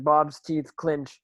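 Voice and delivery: normal talking voice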